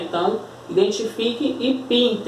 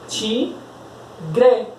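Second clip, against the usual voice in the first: "Tigre" split in two, TI, pause, GRE; syllable by syllable; 3 semitones higher than usual. Shown as a demonstration. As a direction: demonstrate the syllable break in "Tigre". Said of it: "como é que a gente consegue separar". ("Tigre" dito devagar, separando TI e GRE)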